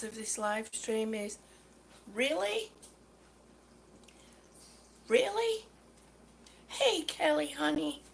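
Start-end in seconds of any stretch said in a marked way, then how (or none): 2.1-5.6 incredulous, rising question; exasperated disbelief. Real, not acted. Annoyed customer addressing the store on a livestream, exasperated disbelief. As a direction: ask it in disbelief, pitch climbing.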